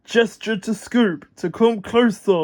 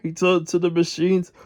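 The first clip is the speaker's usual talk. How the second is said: usual speaking voice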